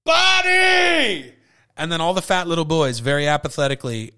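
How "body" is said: put-on "Body!" barked high and loud in a German accent; German-accented drill shout. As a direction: blow the whistle and yell "Body!" like a German coach. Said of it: "very loudly and very Germanly".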